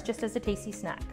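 ordinary talk, nothing marked

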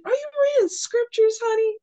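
high-pitched nosy stranger impression; Atlanta garbage man. Garbage man asking a reader about her scriptures, high playful imitation. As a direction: pitch it way up, sugary and familiar. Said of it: "people call you sugar baby, honey".

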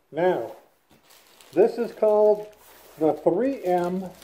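muffled behind face respirator; speaking inside half mask; through a respirator mask. Real, not acted. Seen 2: Half-face respirator covers mouth and nose throughout; R frames unmasked.

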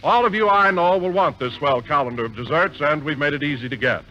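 ordinary delivery

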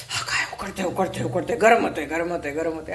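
low, soft whiny imitation of someone moaning about the heat; mock complaining about heat. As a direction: grumble it like a fussy person wilting in the sun. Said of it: "असं म्हणता तुम्ही".

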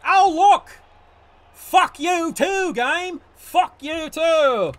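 loud, slow, drawn-out angry shout; furious yell. Real, not acted. Cursing the game after a bad roll, loud slow fury.

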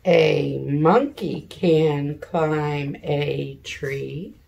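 slow, loud, word by word; pointing at each word as she reads; slow read-along. Shown as a demonstration. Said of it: "Boys and girls".

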